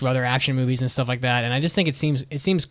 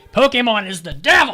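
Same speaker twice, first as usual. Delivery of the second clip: higher, louder preacher voice; fire-and-brimstone preacher; 7 semitones higher, 6 dB louder than usual. Retold or imitated. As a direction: condemn it like a street preacher. Mocking anti-anime preacher.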